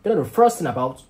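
loud, exasperated dismissal; worked-up, contemptuous griping; scornful outburst. Acted. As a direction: rant scornfully, punching the insults. Scornful rant about church group.

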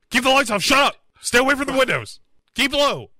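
high, fast frantic orders; paranoid hiss. Retold or imitated. Paranoid parents voiced.

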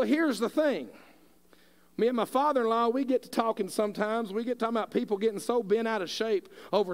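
impassioned sermon voice that keeps building; preaching at full force. Real, not acted. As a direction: preach it hard to the congregation. Preaching about loving your neighbor.

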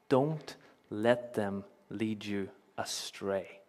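slow, separated words; measured, weighty caution; retold earnest warning. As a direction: quote the warning slowly and deliberately. Retelling a girl's earnest warning.

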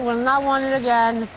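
normal talking voice

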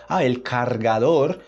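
slow Spanish pronunciation with final R; carefully spoken Spanish. Acted. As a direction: say it as a Spanish speaker. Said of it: "o pessoal sabe espanhol e fala".

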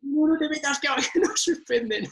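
a laughing imitation of his wife begging him not to go in; imitating wife. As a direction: mimic the panicked wife while cracking up. Imitating his pregnant wife's panic.

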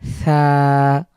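সা with tongue between the teeth and air escaping; breathy tha demo. Shown as a demonstration. Tutor demonstrating airy সা with tongue out. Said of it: "আমি বাতাস বের করছি".

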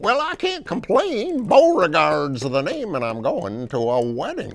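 high, loud, folksy frog character; big high-pitched frog voice; Beauregard the Frog. Acted. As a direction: introduce yourself as a proud cartoon frog. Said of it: "Beauregard the Frog".

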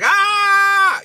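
sudden high, loud startled yell; mock scream. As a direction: shriek like something unholy came on. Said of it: "I would be like".

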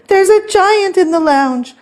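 high-pitched storybook alarm; panicked call. A narrator's reading. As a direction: cry out the frightening news. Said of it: "called Stanley".

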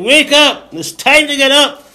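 high, loud, slow wake-up call; rousing high-pitched call; talking alarm clock. Acted. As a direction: play an insistent alarm clock. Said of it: "The alarm is saying".

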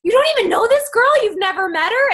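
high, loud excited disbelief; excited screaming. Retold or imitated. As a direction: shriek it like you can't believe it. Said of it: "on the phone with each other screaming".

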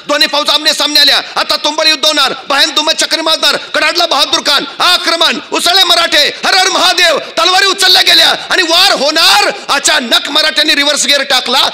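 loud, high-pitched climactic storytelling; rousing battle narration. A narrator's reading. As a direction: build the clash to a fever pitch. (आवेशपूर्ण युद्धवर्णन)

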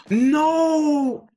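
very high, loud cry of disbelief; shocked no. Real, not acted. Gamer finds the victim's watch. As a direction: shout it in horror. Reacts to the killer reveal.

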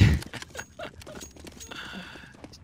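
high squealing laugh; very high pitch. Real, not acted. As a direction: let out a shrill-pitched giggle. Laughing at a teammate's play.

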